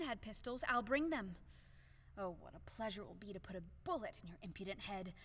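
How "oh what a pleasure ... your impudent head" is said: muttered threat. Acted. Muttered vow of shooting.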